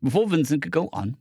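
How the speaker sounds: usual speaking voice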